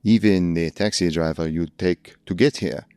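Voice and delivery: hammed-up bad French accent of a Paris concierge; a lightly done, cheesy French accent. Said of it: "the worst French accent I've ever done".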